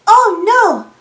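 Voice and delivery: plain reading voice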